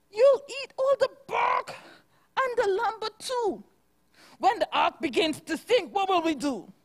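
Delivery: high, bleating sheep complaint. Acted. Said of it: "the sheep began bleating".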